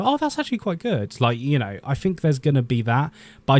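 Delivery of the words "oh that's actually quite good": high-pitched imagined realisation; mock discovery in a much higher voice; acted pleasant surprise. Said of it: "where I go".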